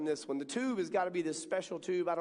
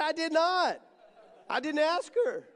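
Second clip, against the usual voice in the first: higher, louder protest; indignant denial; 9 semitones higher, 6 dB louder than usual. Retold or imitated. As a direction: deny it loudly, half laughing. Denies son's accusation.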